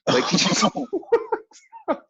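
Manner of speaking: slow, high, laughing through it; can barely get it out for laughing; real laughter over the words. Real, not acted. Laughing retelling the crash.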